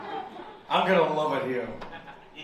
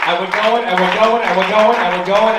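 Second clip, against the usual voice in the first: building hype; 10 dB louder than usual. Real, not acted. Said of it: "slow clap going".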